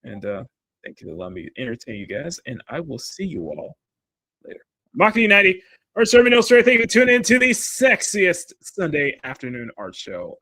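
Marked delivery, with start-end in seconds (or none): 5.0-10.4 loud, raised show-host announcer voice; announcer sign-off. Acted. Host thanking viewers for tuning in, announcer sign-off. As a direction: belt out the show's sign-off.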